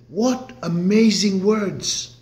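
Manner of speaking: ordinary talk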